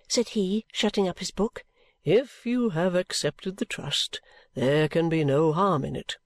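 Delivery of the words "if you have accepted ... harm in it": slow start, settling into a lower voice; measured guardian's voice; kindly guardian, reassuring. Acted. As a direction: reassure as the guardian, unhurried and dropping low.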